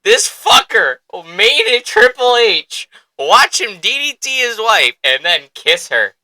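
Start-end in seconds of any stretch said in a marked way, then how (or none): 0.0-4.9 high, loud and slow, each word stretched; incredulous hype. Real, not acted. Fan retelling a shocking wrestling angle, slow incredulous hype. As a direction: relish the outrage.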